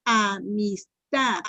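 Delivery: "Amistad" drawn out, TAD stressed; slow syllable-by-syllable. Shown as a demonstration. Teacher quizzing pupils on the stress, "Amistad" slowly split with TAD stressed. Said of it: "la pronuncien por sílabas despacito".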